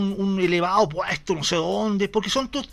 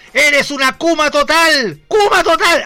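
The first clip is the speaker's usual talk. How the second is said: loud, slow insult, yelled; retold yell; 8 semitones higher, 8 dB louder, slower than usual. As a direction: hurl the insult across the chamber. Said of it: "Flores le gritó". (gritando el insulto, fuerte y lento)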